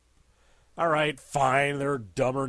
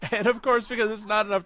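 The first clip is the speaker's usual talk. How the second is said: higher, laughing through it; 6 semitones higher, 4 dB louder, faster than usual. Real, not acted. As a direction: laugh as you start the line. Laughing at his own joke.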